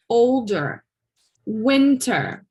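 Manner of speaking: OL-dər, WIN-tər, with a weak second syllable; first syllable stressed. Shown as a demonstration. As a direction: punch the first syllable and let the second fall. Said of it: "Do you guys see the pattern?".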